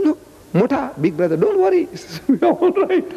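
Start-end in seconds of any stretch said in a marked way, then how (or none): 0.0-1.9 lightly acted, high-pitched don't-worry reply; breezy reassurance. Retells unemployed man's breezy reassurance. 2.3-3.1 high-pitched, mock carefree reply; breezy reassurance. Retells unemployed man's breezy reassurance.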